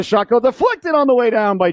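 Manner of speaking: quick surge of play-by-play excitement; excited call. Real, not acted. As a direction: voice jumps up as the puck deflects. Shot suddenly deflected in play.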